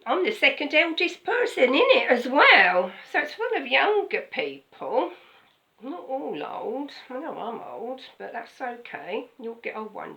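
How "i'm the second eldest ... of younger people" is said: loud jokey aside. Acted. Preacher jokes loudly about age.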